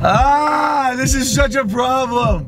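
high, drawn-out cry of frustration; exasperated wail. Real, not acted. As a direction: wail it out, stretching the words. Distressed over prank going wrong.

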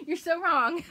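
laughing. Real, not acted. To viewers, laughs off the wrong idea. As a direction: crack up at how wrong they are.

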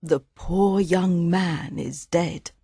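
sad. Acted. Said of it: "says Mother sadly".

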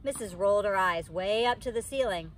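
usual speaking voice

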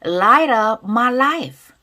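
loud, slow and drawn-out; loud pronunciation demo. Shown as a demonstration. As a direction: model each phrase loudly for learners to repeat. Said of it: "큰 소리 따라볼게". (큰 소리로 천천히 따라 읽기 시범)

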